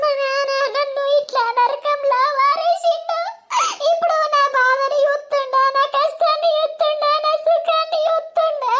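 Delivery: high, wailing lament; crying. Acted. Wife blaming father for her hellish marriage, wailing. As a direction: wail out the grievance, breaking into sobs. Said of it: "ఏడుతున్నావు?".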